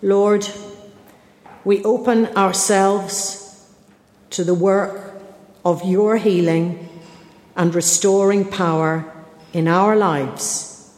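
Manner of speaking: slow, measured prayer with long pauses; slow prayer reading. Real, not acted. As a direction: read it slowly, leaving space after each phrase. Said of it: "I'm gonna read it slowly".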